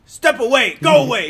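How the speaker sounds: officer's order. Retold or imitated. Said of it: "officer goes".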